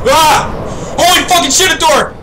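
high panicked shriek; terrified scream. Real, not acted. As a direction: scream in real fright. Startled by a door.

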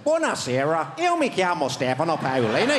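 Italian in a Northern Irish accent. Shown as a demonstration. Comic speaks Italian with a Northern Irish accent. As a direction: give the Italian a Belfast lilt. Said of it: "Italian, but with an Northern Irish accent".

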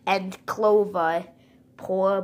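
ordinary talk

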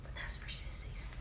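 soft teasing whisper; whispered aside. Real, not acted. Teasing viewers about parmesan in a whisper. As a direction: whisper it like a cheeky secret.

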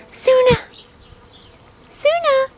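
very high sing-song call. Real, not acted. Calling her duck Suna over, high sing-song.

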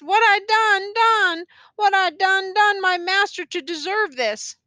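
high, anguished lament; wailing, repeated question; close to tears. A narrator's reading. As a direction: plead upward as if about to cry. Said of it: "she was going to cry".

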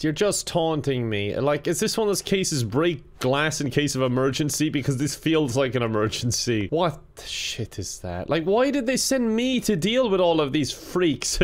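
ordinary talk, nothing marked

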